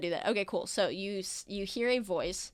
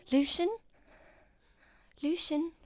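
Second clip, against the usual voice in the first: higher, puzzled repeat of the name; confused disbelief; 8 semitones higher than usual. Acted. A confused patient asks the doctor's name in disbelief. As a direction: ask the name twice, unsure who he is.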